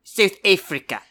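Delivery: put-on a light South African accent, clipped ends; understated South African accent. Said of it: "South African accent".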